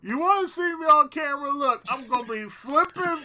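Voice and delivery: high, loud clowning; loud mock excitement. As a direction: ham it up like you're flailing on camera. Acts out flipping on camera.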